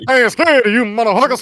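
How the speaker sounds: loud, high, hollered greeting; Danny Glover. Acted. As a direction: burst in with a hammed-up impression. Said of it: "Danny Glover and, uh, impersonation".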